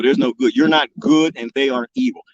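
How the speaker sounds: ordinary talk, nothing marked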